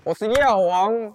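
hyped intro. Acted. Hyped show opening.

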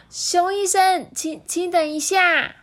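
put-on very high, slow boy call. A narrator's reading. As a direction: stop him urgently. Child calls doctor back. (着急叫住的小孩)

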